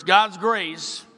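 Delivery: ordinary delivery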